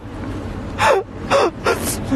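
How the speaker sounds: high, breathless laughing; real helpless laughter; silent wheeze laugh. Real, not acted. Laughing hard.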